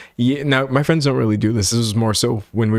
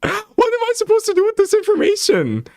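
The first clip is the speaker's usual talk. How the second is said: much higher, squeaky voice through a laugh; squeaky laughing repeat. Shown as a demonstration. To stream chat, a squeaky laughing mock bewilderment. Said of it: "same line repeated, changed".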